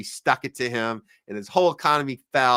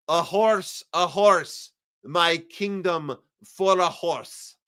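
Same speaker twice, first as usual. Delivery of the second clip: put-on slow, theatrical Russian accent, higher pitched; Russian Shakespearean accent; 4 semitones higher, much slower than usual. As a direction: declaim it like a Russian Putin playing Shakespeare. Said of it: "in a Russian Shakespearean, Russian Shakespearean accent".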